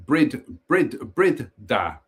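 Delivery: Bridda sounded out as brid-DAH, short "i"; trying out pronunciation. Shown as a demonstration. Reader working out the mansion's name, Bridda as brid-DAH. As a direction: test Brid in pieces, then land on DAH in Bridda. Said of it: "with the emphasis on the second syllable".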